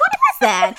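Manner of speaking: high, loud disbelief; incredulous. Retold or imitated. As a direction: squeal it in disbelief. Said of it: "I was like".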